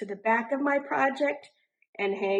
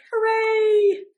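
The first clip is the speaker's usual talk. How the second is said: cheering; 11 semitones higher, 6 dB louder than usual. A narrator's reading. Friends cheer.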